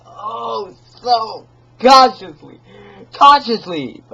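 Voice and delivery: high, loud and drawn out, savouring it; gleeful mad stress; manic emphasis. Acted. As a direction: relish every syllable. Gloating over his own stealth.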